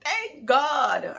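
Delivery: loud, high relief; a mother freed from isolation, loud relief; relieved. Retold or imitated. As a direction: let the relief burst out. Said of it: "so I'm like".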